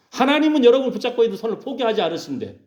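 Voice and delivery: high, forceful pulpit cry; fervent preaching. Real, not acted.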